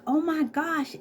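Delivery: high, thrilled oh my gosh; delighted squeal. Retold or imitated. Flattered by the technician's compliment.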